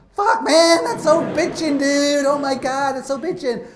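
high, loud, gushing dude talk; stoked surfer dude. Retold or imitated. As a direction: rave about it like a stoked climber. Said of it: "He goes".

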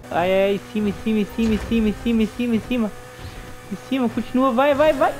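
rising high urgent chant; will the car up the ramp; frantic urging. Real, not acted.

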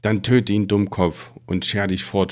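plain reading voice

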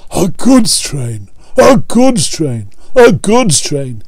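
slow, sulky engine grumble; grumbling Gordon. A narrator's reading. Gordon pulling dirty coal trucks, grumbling slowly. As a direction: drag it out in disgust. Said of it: "he grumbled".

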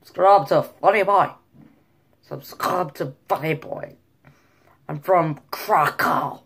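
emphatic imitation of a Polish accent; rough put-on Polish accent; mock Polish accent. Shown as a demonstration. Vlogger claiming to be from Krakow, mock Polish.

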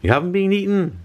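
put-on very high child's yell; mock child's glad yell; happy yell. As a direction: shout it out gleefully. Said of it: "yelled Morris Hope".

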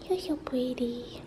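slow tender whisper. Real, not acted. Telling her little baby girl she's pretty in a whisper. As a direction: breathe it softly to the pet. Softly praising the pet.

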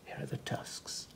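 hushed pointing aside; whisper. Real, not acted. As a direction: whisper it over the page.

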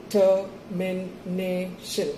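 slow robotic monotone, each syllable separate; robot speak. Shown as a demonstration. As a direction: say Termination like a robot in four even beats. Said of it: "the robot speak method".

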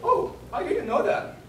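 high-pitched "oh, I didn't know"; acted surprised reply. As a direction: play a surprised lady in a high voice. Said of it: "she said like this".

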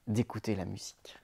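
soft whispered words. A narrator's reading. Narrator whispering to the listening children. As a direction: breathe it out to the children.

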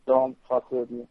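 ordinary delivery

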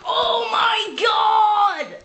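slow, stretched-out shouted gasp; watching the scene and gasping loudly in disbelief; drawn-out shock. Retold or imitated. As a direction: draw the words out in disbelief. Narrator's own reaction, shocked.

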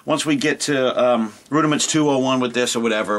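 normal talking voice

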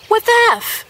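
shocked at the diva option. Real, not acted. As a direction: squeak it in shock.

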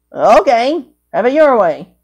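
ordinary talk

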